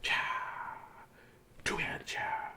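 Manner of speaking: whispered; soft whisper. Real, not acted. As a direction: breathe it as a whisper. Whispered after the dance.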